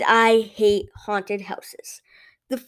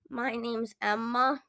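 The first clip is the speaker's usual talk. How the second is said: subdued customer; 9 dB quieter than usual. Acted. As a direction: answer quietly and a bit down. Tired customer answers cashier.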